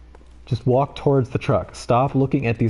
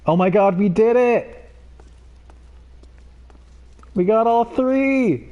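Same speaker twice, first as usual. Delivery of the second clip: triumphant joy; 10 semitones higher than usual. Real, not acted. Celebrating after all three customers hit the truck, much higher elated cheer. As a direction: burst out in victory.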